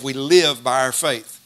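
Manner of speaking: slow, weighted "live", falling at the end; stress on live. Shown as a demonstration. Preacher to congregation, stressing "live", slow. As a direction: lean slowly on "live" in "we live by our faith". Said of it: "I'm emphasizing the word live".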